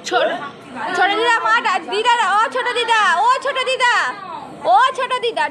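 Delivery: loud high call across the house. Real, not acted. Niece calling out loudly to great-aunt in another room, high loud call. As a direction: call her name so she hears from far.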